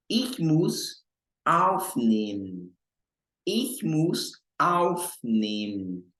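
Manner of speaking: Ich muss aufnehmen spelled out very slowly. Shown as a demonstration. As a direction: drill it word by word. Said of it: "repeating slowly for learners". (bem devagar, palavra por palavra)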